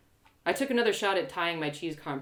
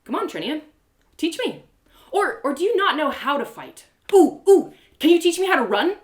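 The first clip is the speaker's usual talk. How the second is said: high, loud goading sing-song; mocking taunt; 9 semitones higher, 9 dB louder than usual. Narrator taunting Trinia mid sparring, high and mocking. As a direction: needle her to make her fight.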